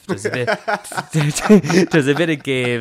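repeats it through laughter; laughing. Real, not acted. Laughing while retelling the crash.

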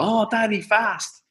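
put-on high small child voice urging; squeaky kid in the back seat; father imitating his young son in the back seat, high. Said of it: "Fionn was going".

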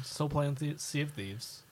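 ordinary talk, nothing marked

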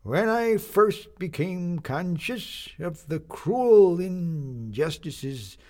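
a doddering captain addressing his shipmates; creaking old sea captain. Acted. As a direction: creak out each word like old ship timbers. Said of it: "in a voice that creaked like timbers".

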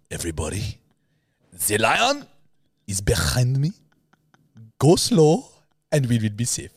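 hammed-up South African park ranger; mocking South African accent. Teasing a co-host's ranger, mock South African accent. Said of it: "It was really bad".

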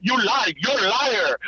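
imitating a child's high-pitched accusation; accusing child. Son's accusation voiced by parent.

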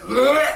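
high gagging noise; retching gag. Retold or imitated. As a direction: mimic a retching gag at a stinking toilet. Plumber gagging at the smell.

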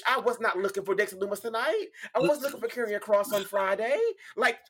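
high, rising disbelief; incredulous. Real, not acted. Astonished at surprise wrestler appearance.

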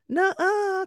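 put-on sing-song like a stubborn kid; defiant kid. Daughter contradicts her mother, defiant. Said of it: "And she's like".

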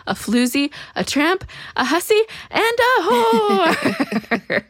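hammed-up crescendo of name-calling, ending in laughter; rising theatrical tirade, last word stretched; building mock insult list.

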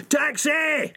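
बहुत ऊँची चीख़ में "Taxi!"; सड़क के बीच टैक्सी को चीख़कर रोकना; screaming taxi. Acted.

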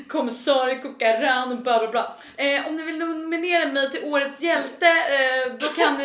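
high, drawn-out imitation of a self-important friend; mock a friend's grandiose appeal for votes; teasing hammed-up boastful babble; hubristic babbling. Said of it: "sen började du babbla".

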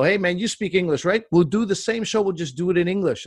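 put-on eager pitch with a touch of French accent. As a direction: lightly mimic a French-Canadian TV guy speaking English. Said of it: "thick French accents".

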